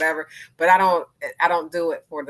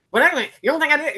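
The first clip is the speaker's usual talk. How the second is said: fast, high, loud defensive snap; 11 semitones higher, 8 dB louder, faster than usual. Retold or imitated. Someone taking advice badly, fast and defensive. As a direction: bristle back quickly. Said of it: "they'll be like".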